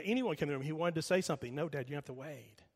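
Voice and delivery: ordinary talk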